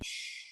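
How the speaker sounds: soft, drawn-out shush; imitated hushing. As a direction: hush the crowd quietly. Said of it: "everyone's going".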